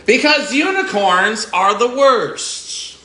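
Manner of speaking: slow and drawn out, leaning hard on Because; slow grand declaration that unicorns are the worst; emphatic verdict. Acted. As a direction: deliver the punchline slowly, every word weighed. Story title line delivered.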